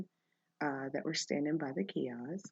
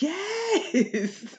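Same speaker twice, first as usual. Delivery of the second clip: much higher, louder yes; eager yes; 11 semitones higher, 5 dB louder than usual. Retold or imitated. Host eagerly answers the women asking about her podcast. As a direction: jump at the chance to answer.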